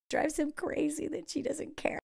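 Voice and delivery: breathy whisper of adoration; hushed, unvoiced fan gush; whispered aside. Real, not acted. Viewer whispering over the film about her favorite actress, hushed.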